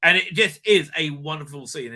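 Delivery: usual speaking voice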